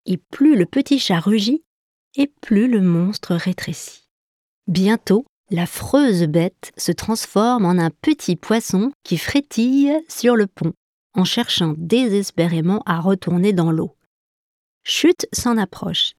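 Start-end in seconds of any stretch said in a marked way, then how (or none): none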